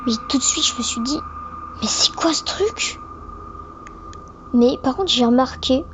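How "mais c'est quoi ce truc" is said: startled whispered question; whispering. Retold or imitated. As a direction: whisper it in alarm. Retold shocked thought, hushed.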